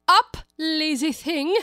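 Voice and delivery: high, loud, slow royal command; bossy queen voice. Acted. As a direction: boom it out like an imperious queen. Queen rouses griffin.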